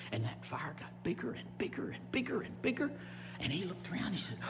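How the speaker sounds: very soft, suspenseful narration; hushed storytelling. A narrator's reading. Storyteller watching the fire creep closer, very hushed. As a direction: drop right down and build the repeats.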